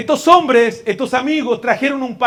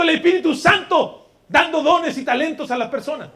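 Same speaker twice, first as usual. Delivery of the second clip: loud, high vow of resolve; fervent preaching; 8 semitones higher, 4 dB louder than usual. Acted. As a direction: promise it to the church with fire. Preacher vows at full force.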